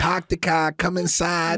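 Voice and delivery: thick put-on Boston accent; old Bostonian man, Boston accent.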